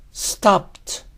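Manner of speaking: usual speaking voice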